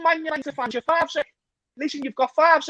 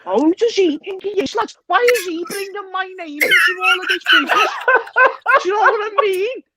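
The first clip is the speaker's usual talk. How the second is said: mock outrage; 5 semitones higher, 4 dB louder than usual. Mock-outraged rant at rival.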